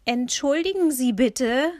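laut und hoch gerufen; rufend. A narrator's reading. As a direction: ruf es empört quer durchs Zimmer. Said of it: "rief Ma ihm entgegen". (Ma ruft dem Fremden im Wohnzimmer laut entgegen)

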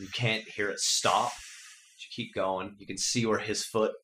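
usual speaking voice

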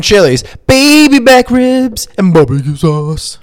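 slow, hammed-up announcer voice; mock ad read. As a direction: oversell it like a cheesy commercial.

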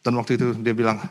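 ordinary talk, nothing marked

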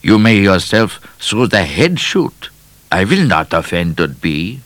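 put-on emphatic foreign-accented voice; foreign explorer. A narrator's reading. Explorer dismissing Midnight, emphatic accented voice. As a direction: pronounce it grandly with a foreign accent.

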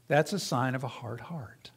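a low whispered warning. Real, not acted. Preacher whispering a warning to the congregation. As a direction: breathe it out, grave and hushed.

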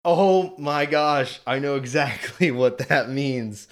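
shocked realization. Retold or imitated. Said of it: "and I went".

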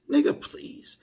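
high, loud scoffing cry; loud dismissive exclamation. Shown as a demonstration.

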